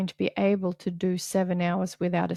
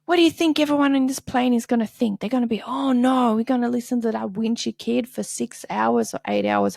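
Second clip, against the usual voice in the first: scolding mom; 4 semitones higher, 4 dB louder than usual. Retold or imitated. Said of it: "And she goes".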